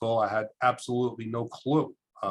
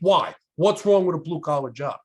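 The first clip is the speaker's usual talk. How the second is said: louder, higher imitated challenge; challenging nun; 5 semitones higher, 5 dB louder than usual. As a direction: demand a justification as the nun. Nun challenges student's snub.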